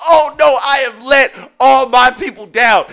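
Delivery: high, loud, shouted slow mock panic; embarrassed panic. Man caught loving watermelon panics, shouting high and loud. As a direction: wail like you let everyone down. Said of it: "I was so embarrassed because I was like".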